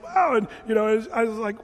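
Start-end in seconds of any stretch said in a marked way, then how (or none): 0.0-0.4 high, loud wow of wonder; amazed wow. Retold or imitated. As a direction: marvel at it, much higher. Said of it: "and he was just like".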